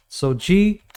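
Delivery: ordinary delivery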